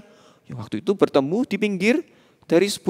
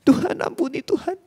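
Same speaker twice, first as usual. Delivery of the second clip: high breathy whisper begging forgiveness; tearful whispered plea; 10 semitones higher than usual. Acted. Crying gang members begging God for mercy. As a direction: whisper it through mock tears. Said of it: "semuanya menangis".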